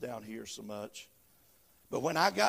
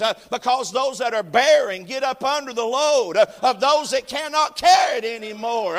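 louder, higher preacher's cadence with "uh" breaths; full-force preaching; 9 semitones higher, 12 dB louder than usual. Acted. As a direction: build it up like a revival preacher.

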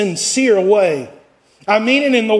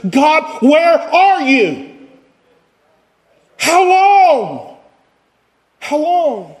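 anguished cry; 6 semitones higher, slower than usual. Acted. Preacher crying out as David.

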